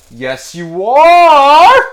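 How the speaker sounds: high, very slow put-on scarecrow voice; mock scarecrow reply. Talking to himself, answers as the scarecrow in a high drawn-out voice. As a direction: answer yourself as a silly scarecrow.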